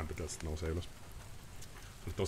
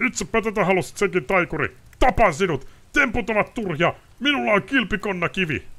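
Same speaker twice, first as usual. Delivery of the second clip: high, loud, indignant ranting lord; haughty noble raging, sword half drawn; outraged nobleman; 11 dB louder than usual. Acted. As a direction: spit contempt at the witcher. Ostrid draws sword, threatens.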